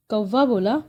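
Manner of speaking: ordinary delivery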